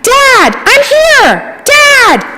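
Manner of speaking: put-on high, loud little boy's cry; child calling out. Trapped boy shouting up to his father, high and loud. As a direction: call up to Dad from under the rubble.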